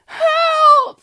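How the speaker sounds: shrill scream for help, very high and loud. A narrator's reading. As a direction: shriek it in panic. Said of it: "screamed Missus Twit".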